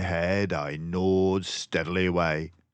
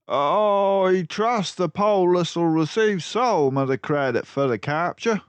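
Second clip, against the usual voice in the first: polite constable; 12 semitones higher, 6 dB louder than usual. Acted. Constable asking Basil about credit. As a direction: ask deferentially.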